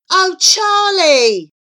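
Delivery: loud, high, drawn-out reproach; theatrical exasperation; exasperated exclamation. A narrator's reading. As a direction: scold with long, falling vowels. Flora dismisses Charlie's fears.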